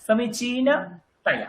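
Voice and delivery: slow, segmented সমীচীনতায়া with a break before taya; split before taya. Shown as a demonstration. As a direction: stretch সমীচীনতায়া, pausing before the ending taya. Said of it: "তার আগের সিলেবলটাকে একসঙ্গে উচ্চারণ করুন".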